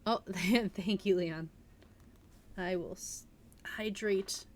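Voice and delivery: plain reading voice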